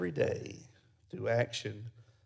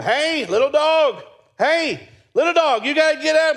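much higher, louder pleading calls; urgent calling; 12 dB louder than usual. Retold or imitated. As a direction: try harder to wake the dog. Urgent calls to dead-seeming dog.

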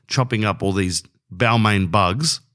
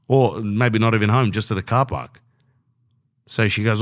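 usual speaking voice

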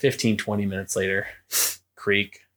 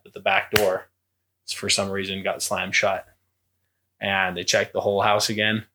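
normal talking voice

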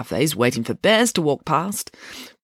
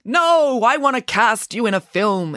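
high, pushy casting director's pitch; enthusiastic; 7 semitones higher, 4 dB louder than usual. A narrator's reading. Casting director offers Boris a film part, enthusiastic. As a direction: burst out with it, high and keen. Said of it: "enthused the casting director".

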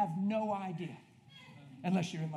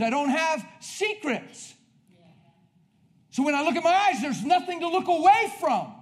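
loud, high, fervent pulpit preaching; preaching at full force; 8 semitones higher, 9 dB louder than usual. Acted. Preacher rallying the congregation, full-force preaching. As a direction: thunder it out to the congregation.

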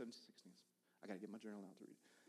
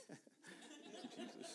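laughing thanks; 9 dB quieter, much slower than usual. Real, not acted. Laughing thanks after "she said yes.

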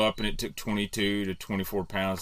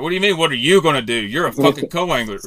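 fast, loud, higher retold yell; retold angry outburst; 6 semitones higher, 8 dB louder, faster than usual. Angler retells yelling at his co-angler, loud and fast. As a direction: snap at the useless partner, fast. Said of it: "And I just lost it".

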